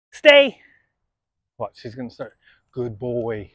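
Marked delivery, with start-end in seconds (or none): 0.1-0.6 firm command. Real, not acted.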